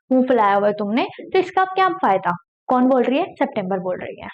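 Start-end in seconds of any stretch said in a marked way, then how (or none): none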